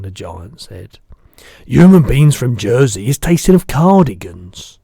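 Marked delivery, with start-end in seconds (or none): 1.7-4.8 the giant's voice. Acted. Giant correcting the girl, big booming voice.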